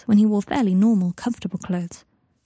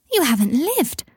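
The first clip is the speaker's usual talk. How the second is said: put-on higher, swooping childish amazement; incredulous; 5 semitones higher, slower than usual. A narrator's reading. Teddy to Scorpius who never visited, incredulous and high. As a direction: pronounce it with exaggerated disbelief.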